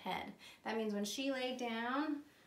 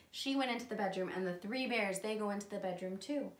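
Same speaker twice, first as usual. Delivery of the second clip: ordinary delivery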